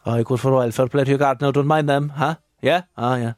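put-on Kerry accent. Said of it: "could you keep it up".

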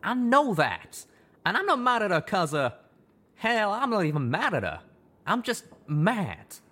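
high, loud, frustrated confession; he owns up to being mad; admitting anger. Acted. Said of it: "Calamity admitted".

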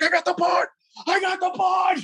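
very high excited mock scream; retold joyful scream. Actor reliving his news in the studio hallway, screaming. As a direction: re-enact screaming it out, pitch shooting way up. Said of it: "I'm screaming in the hallway".

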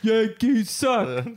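slow, loud New York jeer; New York accent. Pretend. Host jeering the Yankees in a slow New York accent. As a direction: drag it out like a bitter fan. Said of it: "Now I'm doing it for the rest".

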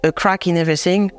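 English quote in a heavy French accent. Shown as a demonstration. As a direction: say Cohen's line in English with a thick French accent. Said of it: "avec mon accent pourri".

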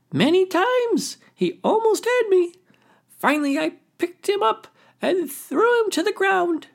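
high, loud bragging squeak; boastful excited. Acted. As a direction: brag it up big. Crayfish boasts of fight.

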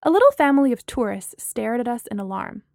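ordinary delivery

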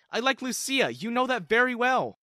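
high, loud firm rebuke; angry insistence. Acted. Terrence telling Diana off, loud and angry. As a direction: push her away with your voice.

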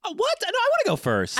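squeaky high protest; mock outrage. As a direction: whine it way up high. Playful protest over turn.

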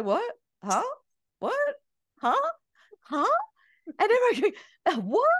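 high, puzzled "What? Huh?"; mock disbelief. Watching the election results come in, stunned and high-pitched. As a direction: act out stunned confusion at the results.

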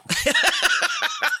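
high squealing laugh; real high-pitched laugh mid-bit; giggle. Real, not acted.

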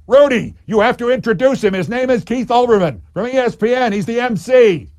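loud, high roar, pushing each word. Retold or imitated. Deputy mayor ordering the mayor to introduce him, roaring. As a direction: roar the instruction right at him. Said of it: "now roared at him".

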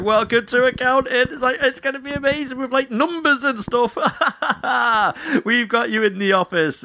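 high, slurred mock-drunk cartoon voice with laughs. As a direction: play the tipsy office guys, laughing. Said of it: "they just sound pissed".

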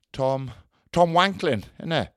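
loud, high imitation of an older man; stranger recognising someone. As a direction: call out the name as if you've placed it. Acts out older man placing his name.

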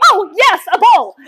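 high, booming sudden realisation; hammed-up cry of agreement; loud mock eagerness. Told to go get a bowl, loud mock eagerness.

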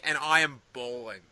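normal talking voice

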